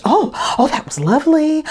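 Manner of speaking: high, gushing mock praise; suddenly sweet director. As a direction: switch to charmed delight. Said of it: "a totally different person after I finished".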